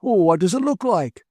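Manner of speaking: put-on high, testy old man retort; snapping back. A narrator's reading. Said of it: "the elderly man snapped back".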